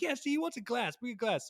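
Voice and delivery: put-on a bossy old Italian uncle yelling orders; imitating Joe barking for a glass.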